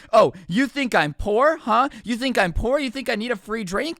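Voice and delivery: heated mock accusation; imitated angry yelling. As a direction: act out an offended customer yelling. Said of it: "She starts yelling at me".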